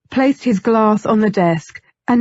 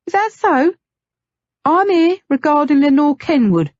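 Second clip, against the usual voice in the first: mocking put-on posh English accent, pitched up; affected posh accent; 7 semitones higher than usual. Nettie mocking Nathaniel's words in an affected English accent. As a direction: parrot his words back in a plummy English accent to tease. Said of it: "she repeated in an affected accent".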